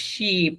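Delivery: "শিপ" held long on ee, shee-p; drawn-out vowel. Shown as a demonstration. Teacher repeats the needed stretch, drawn-out vowel in "শিপ". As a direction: stretch "শিপ" slowly with a falling glide. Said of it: "টান হবে".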